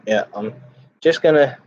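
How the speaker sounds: ordinary talk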